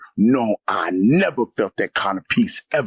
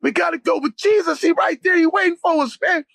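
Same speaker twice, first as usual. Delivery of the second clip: very high, fervent retold shout; fired-up cry. Insisting to the possessed friend Jesus waits, fervent cry. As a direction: keep calling out insistently, high-pitched. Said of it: "I kept saying".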